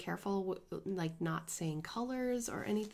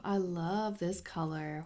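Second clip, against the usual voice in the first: usual speaking voice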